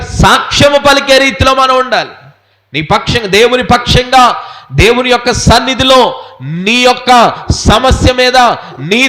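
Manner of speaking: forceful preacher in full flow; impassioned preaching. Real, not acted. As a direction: hammer each phrase home to the congregation. Preaches God standing with you.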